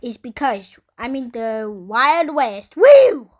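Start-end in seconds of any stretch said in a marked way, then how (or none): 2.8-3.3 loud high whoop; loud excited cowboy whoop. Pretend. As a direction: whoop like a rodeo rider. Cowboy whoop in Wild West game.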